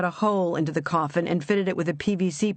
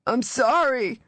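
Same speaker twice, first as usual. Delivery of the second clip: raw, frightened apology, higher; buried man apologising in terror; terrified; 7 semitones higher, slower than usual. A narrator's reading. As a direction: plead through fear. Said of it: "Walter's raw, terrified voice".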